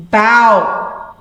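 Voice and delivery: "bow" rhymes with "cow"; "bow" as in bowing to a king; bow as in bend. Shown as a demonstration. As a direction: say "bow" with the ow of "how". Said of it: "another way of pronouncing".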